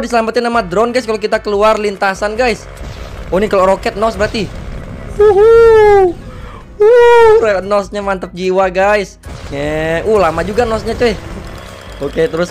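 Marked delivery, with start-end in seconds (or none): 5.1-7.3 very loud, high drawn-out whoops; excited whooping. Real, not acted. Gamer riding a rocket boost, loud high whooping. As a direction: whoop at the top of your voice.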